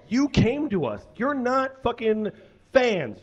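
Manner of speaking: ordinary talk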